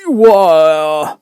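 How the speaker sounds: high, loud, important bird voice; grand old bird holding forth; pompous Dodo. Acted. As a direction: puff up like a self-important Dodo.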